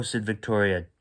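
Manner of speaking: usual speaking voice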